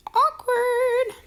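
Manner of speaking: high, slow, drawn-out "awkward". Real, not acted. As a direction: let "awkward" hang in the air, high and slow. Sarcastic remark.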